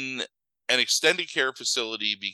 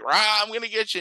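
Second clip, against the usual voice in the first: playful mock growl; 11 semitones higher than usual. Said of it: "he's like".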